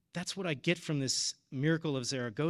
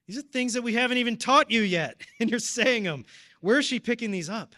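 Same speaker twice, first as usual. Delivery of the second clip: much higher and louder, laughing in wonder; amazed parent; 7 semitones higher, 8 dB louder than usual. Retold or imitated. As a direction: marvel at the little girl. Parents marvelling at daughter Mary.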